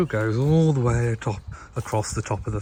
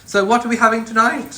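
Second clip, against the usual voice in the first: calling out; 11 semitones higher, 5 dB louder than usual. Real, not acted. Calling out to partner.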